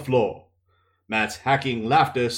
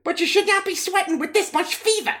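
much higher, worried woman; Nynaeve's voice. Acted. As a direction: let the worry show, pitched high. Nynaeve speaks.

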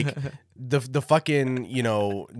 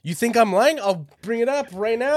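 higher, louder, indignant; defensive challenge; 10 semitones higher, 3 dB louder than usual. Real, not acted. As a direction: dare him to doubt you. Defending kanji claim, higher voice.

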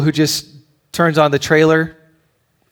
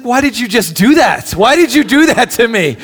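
higher, louder mock-hurt wife; wife's reproach; 8 semitones higher, 7 dB louder than usual. Wife scolding husband for the tearjerker trailer, higher and louder. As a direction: playfully accuse him of making you cry. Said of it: "she's like".

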